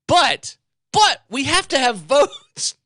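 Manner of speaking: high, slow, loud mock Pelosi voice. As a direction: drag out "we have to have votes" in a parody of her. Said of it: "we're gonna pass both bills".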